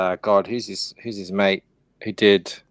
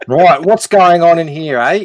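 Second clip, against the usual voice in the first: a hammed-up Aussie bloke, a bit louder; mock Australian accent; 5 dB louder than usual. Friend teasing the Americans on stream in a mock Australian accent. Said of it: "you blokes are destroying our language".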